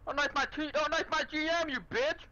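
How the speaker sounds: very high, squealing mock scold. Player scolds teammate for knifing the GM, high mock squeal.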